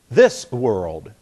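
"This world" as two separate words; unhurried. Shown as a demonstration. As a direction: finish the s before starting "world". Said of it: "your tongue can't do that very quickly".

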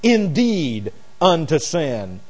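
slow, weighty stress on "indeed unto sin"; emphatic underlining. Shown as a demonstration. Preacher double-underlining the phrase for listeners, slow stress. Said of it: "like double underlining".

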